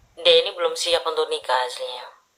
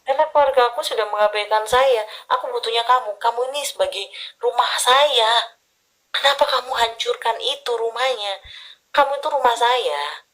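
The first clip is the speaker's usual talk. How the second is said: lightly voiced pleading, pitched higher; pleading girlfriend; 7 semitones higher than usual. Reader voices the client begging her boyfriend, higher pleading. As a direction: plead with him like he is your only home. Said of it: "Sudah kamu bilang gitu".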